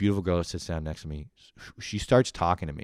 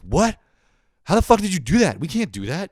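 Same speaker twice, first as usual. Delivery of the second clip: high, loud stunned outburst; shocked and baffled, voice jumping up; mock outrage at the news; retold shock; 11 semitones higher, 7 dB louder than usual. Retold shock at friends' plan.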